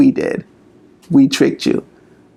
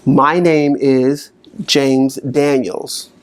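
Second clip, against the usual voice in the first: slow, deliberate naming; slow declaration; much slower than usual. Shown as a demonstration. Coach modelling his own name, slow and deliberate. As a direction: state it slowly and firmly. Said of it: "Let's try again".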